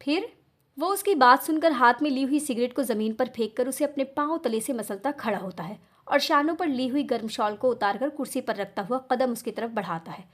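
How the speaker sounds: ordinary delivery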